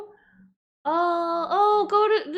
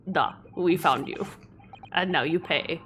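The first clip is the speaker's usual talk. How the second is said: low, softer Russian menace; low mock-Russian villain; Russian accent; 5 semitones lower, 6 dB quieter than usual. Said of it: "my Russian accent".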